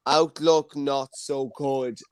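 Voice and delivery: slow, drawn-out eight ball verdict; slow reading. Acted. Host reading the magic eight ball's answer to the room, slowly. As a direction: read the answer out slowly and deliberately.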